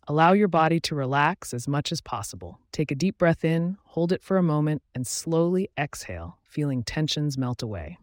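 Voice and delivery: measured guided-visualization delivery with long pauses; guided meditation. Acted. Host guiding listeners into a relaxing visualization, calm meditation voice. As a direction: guide the listener gently through each image, pausing between steps.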